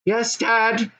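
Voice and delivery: put-on high young goblin son. Goblin son answering his dad, high and eager. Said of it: "said a third voice".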